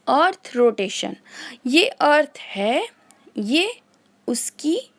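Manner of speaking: normal talking voice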